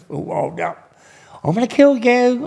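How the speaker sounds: squeaky high, hammed-up threat; high mock threat. Inmate [name] jokingly threatening him, high mock voice. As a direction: pitch it way up like a joke menace.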